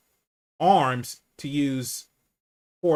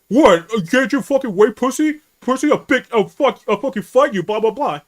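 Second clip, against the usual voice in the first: loud, high angry rant, imitating the cashier; irate cashier; 9 semitones higher, 9 dB louder than usual. Cashier picking a fight with a customer, angry yelling. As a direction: blow up at the customer, mock-furious. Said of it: "accusing me of raising my voice".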